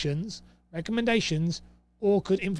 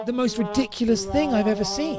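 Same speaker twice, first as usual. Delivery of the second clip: higher and indignant, real annoyance; exasperated outrage; 5 semitones higher, 3 dB louder than usual. Real, not acted. Annoyed broker ranting to viewers about the network's rules, exasperated. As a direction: let the outrage spill over the rule. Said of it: "I'm still getting angry now".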